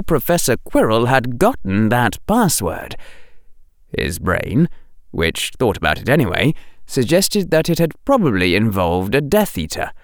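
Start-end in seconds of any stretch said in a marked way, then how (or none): none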